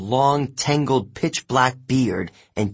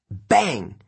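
ordinary delivery, nothing marked

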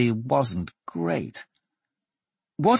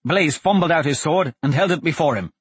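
usual speaking voice

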